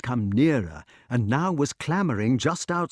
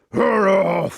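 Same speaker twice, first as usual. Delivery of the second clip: loud, higher-pitched bark; loud baying woof; beast's bark; 8 semitones higher, 6 dB louder than usual. A narrator's reading. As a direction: bark it like a great hound. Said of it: "barked the questing beast".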